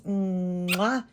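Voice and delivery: short air kiss with a lift at the end; blown kiss. Real, not acted. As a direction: blow the viewers a quick kiss. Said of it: "And I send you a kiss too".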